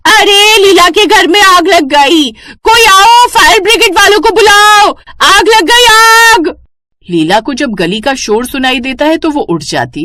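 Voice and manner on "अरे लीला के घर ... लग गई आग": very high, loud fire alarm shout. Acted. Neighbour alerting street about fire, loud shouting. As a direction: scream for help to the whole street. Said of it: "जोर जोर से शोर मचाने लगती है".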